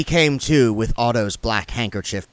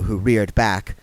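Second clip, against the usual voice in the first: ordinary delivery, nothing marked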